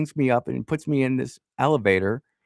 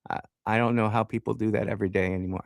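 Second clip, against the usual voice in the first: usual speaking voice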